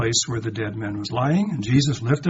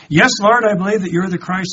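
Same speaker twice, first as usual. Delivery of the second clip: loud and much higher, fervent; fervent confession; 7 semitones higher, 10 dB louder than usual. Acted. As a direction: cry out your faith to the Lord. Martha's confession voiced by preacher.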